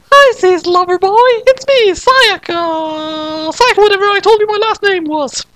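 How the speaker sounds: sweet fake girlish greeting; high girl voice. Madoka disguised as Sayaka greeting Kyosuke, high girl voice.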